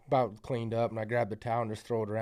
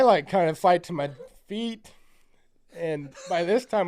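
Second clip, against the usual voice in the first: normal talking voice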